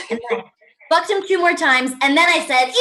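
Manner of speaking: ordinary talk, nothing marked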